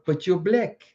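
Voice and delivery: rough attempt at South African; amateur South African impression; South African accent. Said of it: "I can't do accents very well".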